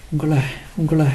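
soft, faltering start, repeated; lost for words. A narrator's reading. Tearful wife trying to thank her husband, softly faltering. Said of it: "வார்த்தை கிடைக்காமல் தடுமாறினாள்".

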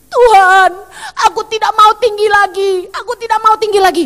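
sobbing plea; crying prayer. Retold or imitated. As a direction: plead through tears. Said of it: "saya ngomong gini".

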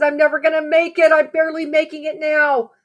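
high, rushed, despairing plea; fast retold panic, running out of strength; panicky. Dreamer telling the voice she can't make it, high and fast. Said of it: "And I felt panicky".

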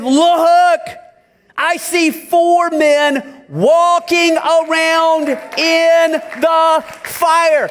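high, loud and slow, drawn-out wonder; amazed cry. Acted. The king calling to his advisors in amazement. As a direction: cry out in astonishment at the sight.

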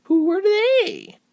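very high, loud, drawn-out question; high-pitched, nosy excitement; excited old lady. A narrator's reading. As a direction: ask eagerly as a little landlady. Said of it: "the lady answered excitedly".

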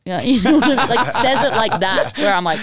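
laughing through the words; can't stop giggling while explaining. Real, not acted. Laughing at the story, telling the friend.

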